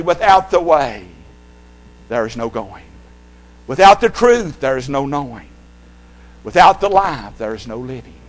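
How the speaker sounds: rhythmic preaching refrain; pulpit rhythm. Shown as a demonstration. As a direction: call and fall, like a pulpit refrain. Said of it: "I used to say from the pulpit".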